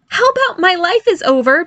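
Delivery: put-on high-pitched boy's sardonic quip; sarcastic quip. Barry answers mother about fairy name, sardonic quip. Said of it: "Barry quipped".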